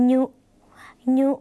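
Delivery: "ยุ" as a short nasal nyu; isolated demo of "ยุ" with ny for y; nasal Lao ny. Shown as a demonstration. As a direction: say "ยุ" through the nose, nyu, then repeat it after a pause. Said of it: "ออกเสียงว่า".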